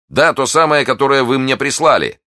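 high, loud, eager confirmation; emphatic insistence. A narrator's reading. D'Artagnan confirming the gift to Athos, emphatic. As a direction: insist brightly on the obvious.

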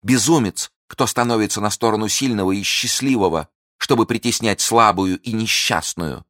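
high, loud pitying contempt; impassioned tirade; scornful outburst. Acted. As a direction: lash out at his blindness. Milady's outburst continues.

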